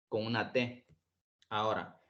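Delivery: usual speaking voice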